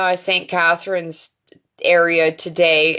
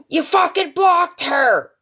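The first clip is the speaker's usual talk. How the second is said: friend losing it; 9 semitones higher than usual. Retold or imitated. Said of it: "And my friend lost it".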